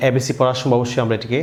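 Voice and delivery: usual speaking voice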